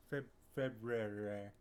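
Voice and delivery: February started twice, Feb- then the full word; stumbling February. Shown as a demonstration. Host greeting viewers, stumbles on "February" on purpose. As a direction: trip on "Feb-" and restart "February". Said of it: "We had this conversation already".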